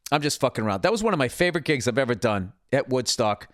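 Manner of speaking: normal talking voice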